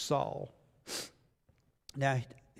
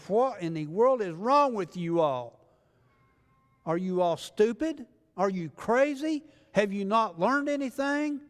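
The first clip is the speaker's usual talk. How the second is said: exasperated scolding, rising higher on the questions; mad; 5 semitones higher, 4 dB louder than usual. Retold or imitated. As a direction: act out an angry prophet berating the people. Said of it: "Samuel got mad".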